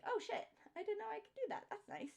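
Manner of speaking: high, soft note of discovery; surprised aside. Retold or imitated. Employee learning a till trick by watching, high quiet surprise.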